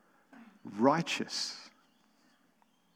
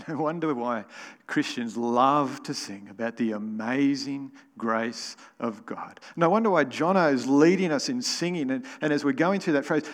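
ordinary talk, nothing marked

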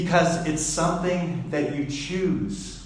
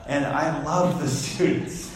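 ordinary talk

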